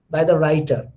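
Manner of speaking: usual speaking voice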